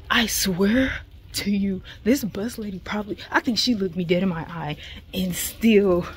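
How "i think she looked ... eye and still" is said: ordinary talk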